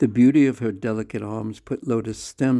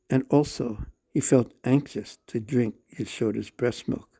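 ordinary delivery

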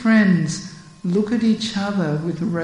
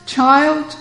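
higher lamenting elder voice; grieving elder; 7 semitones higher than usual. Acted. Mukara tells the child of Radha's grief. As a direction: explain the sorrow heavily.

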